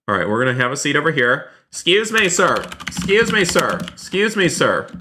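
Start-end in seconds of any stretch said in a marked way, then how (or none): 1.7-4.9 high-pitched "excuse me", dropping on "sir"; calling out. Pretend. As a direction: call for a stranger's attention, insistently. Calling to another player.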